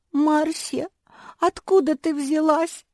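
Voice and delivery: high, loud tearful question; sobbing. A narrator's reading. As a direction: wail it as a mock sob. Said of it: "всхлипнула Жози". (плаксиво, высоким голосом)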